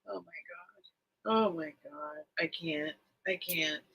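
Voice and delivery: breathless near-whisper, fighting tears; overwhelmed, voice almost gone; can barely get the words out, close to crying; choked up. Real, not acted. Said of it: "I'm gonna start crying".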